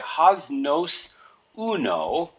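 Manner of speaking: Has nos uno, slow and syllable by syllable; careful slow Has nos uno; slow Spanish. Shown as a demonstration. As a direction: read the phrase slowly for learners. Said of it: "So we would say".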